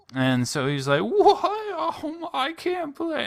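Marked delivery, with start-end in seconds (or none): 1.0-3.2 very high, squeaky whine; whiny character voice. Retold or imitated. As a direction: whine like a cornered kid.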